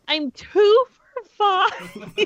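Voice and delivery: high slow laughing wail; laugh-cry at losing; laughing despair. Real, not acted. Laughing at own failure.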